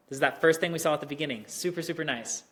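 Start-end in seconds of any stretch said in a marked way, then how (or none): none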